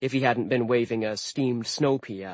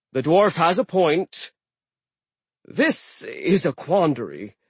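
slow, musing sea god; thoughtful sea giant; 6 semitones higher, 4 dB louder, slower than usual. Acted. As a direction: weigh it over slowly. Aegir ponders.

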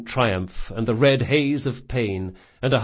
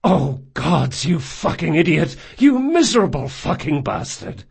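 higher, louder, frantic swearing; anguished cursing; 5 semitones higher, 4 dB louder than usual. Acted. Jean curses the bleeding Locke in a loud, high frantic voice. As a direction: rage that is really fear.

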